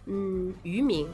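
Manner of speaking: normal talking voice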